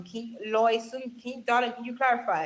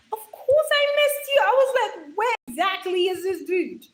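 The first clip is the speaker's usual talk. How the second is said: very high, loud, hammed-up outcry; gushing exclamation; 8 semitones higher, 5 dB louder than usual. Streamer greeting a missed viewer, exclaiming in high mock outrage. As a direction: exclaim it in a high, playful wail. Exaggerated reaction to a returning viewer.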